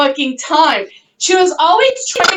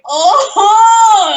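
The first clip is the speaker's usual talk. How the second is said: acted dismay; 6 semitones higher, 4 dB louder than usual. Reacting to family's arrival, acted.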